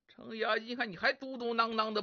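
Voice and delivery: usual speaking voice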